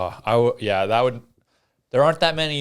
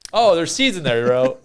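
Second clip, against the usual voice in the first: louder, higher cry of disgust; excited disgust; 5 semitones higher, 6 dB louder than usual. Real, not acted. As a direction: react loud to bitter seeds. Bit into raw cranberries.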